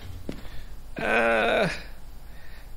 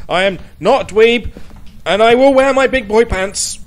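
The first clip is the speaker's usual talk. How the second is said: louder, higher playful protest; mock indignant; 6 semitones higher, 8 dB louder than usual. Real, not acted. Player retorting to chat calling him a dweeb, mock indignant. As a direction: defend yourself loudly, half joking. Answering a teasing chat message.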